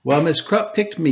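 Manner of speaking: usual speaking voice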